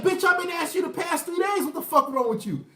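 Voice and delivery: fed-up boyfriend, acted loud and high; exasperated outburst. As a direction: blow up after days of asking. Boyfriend snapping after three days.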